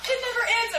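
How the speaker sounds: high, loud mock wail; hammed-up exasperation; exasperated complaint. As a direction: wail it in comic despair. Retells boredom at long video.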